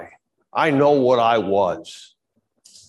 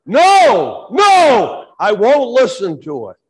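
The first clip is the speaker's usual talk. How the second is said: much higher, loud shouted "No, no"; yelling; 7 semitones higher, 6 dB louder, slower than usual. Shown as a demonstration. Pastor acting out rejecting the devil, loud shouted refusal. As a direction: shout the refusal at the top of your lungs. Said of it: "And I started yelling now".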